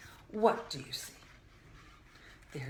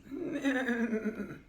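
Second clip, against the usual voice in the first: horse whinny; 4 semitones higher, 7 dB louder than usual. Acted. Blue horse appears in book.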